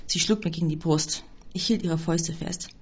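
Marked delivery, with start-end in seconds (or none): none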